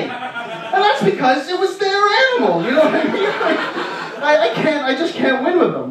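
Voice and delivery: high, laughing rant; laughing exasperation. Real, not acted. Comic ranting about his buddy, laughing high. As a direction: crack up in disbelief at losing again.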